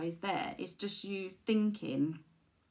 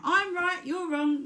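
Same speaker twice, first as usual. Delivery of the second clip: high, loud bratty ego insisting; mock ego; 10 semitones higher, 7 dB louder, slower than usual. Speaker voicing the stubborn ego, high and loud. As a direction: make the ego stamp its foot.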